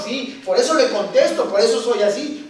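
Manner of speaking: ordinary delivery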